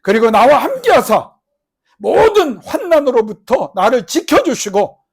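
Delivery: loud, high, slow pleading; crying out in prayer. Acted. Jabez crying out to God, loud and pleading. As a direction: pour a lifetime of grief into the plea. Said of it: "한해 맺힌 기도를 맨날 했다는 거예요".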